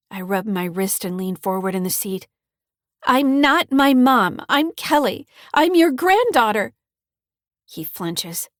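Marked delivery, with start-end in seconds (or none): 3.0-6.7 upset insistence. Acted. Girl insists to confused grandfather.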